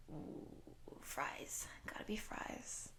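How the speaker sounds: soft, hushed relish; dreamy craving. Acted. Shapeshifter naming a favourite human food, hushed craving. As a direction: savour the thought under your breath.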